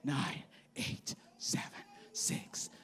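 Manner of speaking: breathless whispered numbers; whispered countdown. Retold or imitated. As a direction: whisper it as the moment nears. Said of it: "count down with me".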